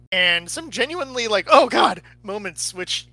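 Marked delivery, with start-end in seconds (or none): 1.5-2.0 loud startled oh God; mock horrified gasp. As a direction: blurt it out like a scare. Imitating a horror scare moment.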